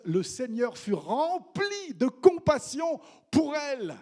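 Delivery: stately scripture reading; solemn reading. Acted. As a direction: lift the verse, slow and reverent. Reading key verse.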